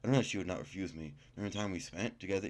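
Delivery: ordinary talk, nothing marked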